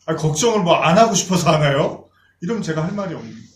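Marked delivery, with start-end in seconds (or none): 0.1-2.1 indignant congregant. Retold or imitated. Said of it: "그러면".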